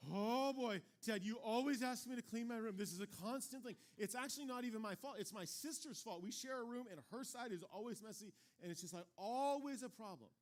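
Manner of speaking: put-on loud, high complaining kid voice; whiny excuse-making kid. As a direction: whine every excuse like a put-upon kid. Other kid making excuses, imitated.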